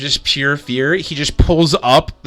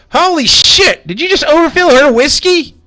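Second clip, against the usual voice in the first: much higher, louder outraged squeal; shocked high cook; 14 semitones higher, 6 dB louder than usual. Acted. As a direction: squawk in disbelief. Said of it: "And he goes".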